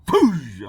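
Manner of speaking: loud, high explosive "poosh"; imitated crash. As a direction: burst out the impact noise. Imitates the truck hitting.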